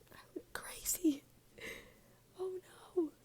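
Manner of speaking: high squeaky whisper; whispered dismay. Real, not acted. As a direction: squeak it in disbelief. Reacting to own appearance.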